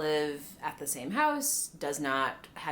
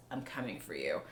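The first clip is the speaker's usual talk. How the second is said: low quiet threat; 10 semitones lower, 9 dB quieter than usual. Retold or imitated. Threat ending the episode.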